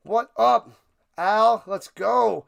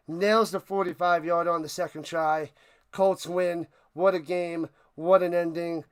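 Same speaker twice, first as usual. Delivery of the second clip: ordinary talk, nothing marked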